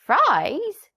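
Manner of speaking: a very high shocked squawk; startled and disbelieving; surprised hen. A narrator's reading. Hen hears the donkey wants fries, high surprise. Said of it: "said Nanny Hen".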